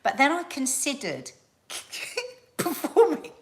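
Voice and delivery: high, laughing retelling of the friend; laughing quote. Retold or imitated. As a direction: giggle through the punchline. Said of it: "And she said".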